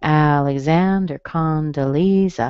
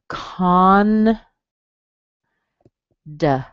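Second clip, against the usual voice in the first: very slow "con, da" said syllable by syllable; slow syllable split. Shown as a demonstration. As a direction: break the word apart slowly. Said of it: "the schwa on the second one".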